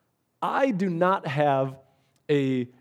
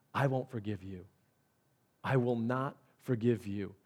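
hushed, much softer grave warning; solemn warning; 4 semitones lower, 8 dB quieter than usual. Pretend. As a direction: drop to a quiet, grave voice. God's words voiced, solemn.

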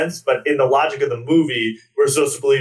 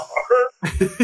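ordinary delivery

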